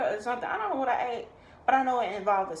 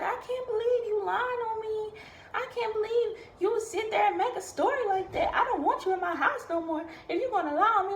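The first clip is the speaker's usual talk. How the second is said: high, whiny mock-sobbing lady voice; mocking her fake crying; 11 semitones higher than usual. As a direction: imitate her fake tears, pitched way up. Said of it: "This lady started crying".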